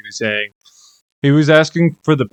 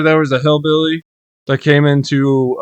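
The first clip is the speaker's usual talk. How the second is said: usual speaking voice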